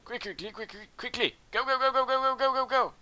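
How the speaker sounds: high-pitched, frantic urging, building to loud; urgent rush. Real, not acted. Urging troops on during game.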